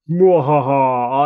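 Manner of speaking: slow drawn-out evil cackle; mad scientist cackle. Pretend. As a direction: cackle like a gleeful villain. Toy villain gloating.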